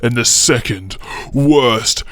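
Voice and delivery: very slow, stretched-out title announcement; hammed-up elongated announcer call; dramatic countdown. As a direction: drag each word out to a huge finish.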